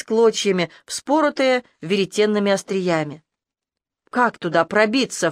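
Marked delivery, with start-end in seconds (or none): none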